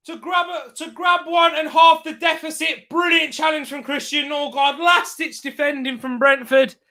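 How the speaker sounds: high, urgent football commentary; live match call at high pitch; excited live play-by-play. Real, not acted. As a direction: shout the attack, then keep the pitch up.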